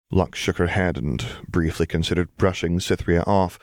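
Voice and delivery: ordinary delivery, nothing marked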